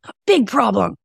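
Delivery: high, gleeful put-on voice; high delighted mock voice; brain as eager character. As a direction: play the brain relishing a problem. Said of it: "Your brain's like".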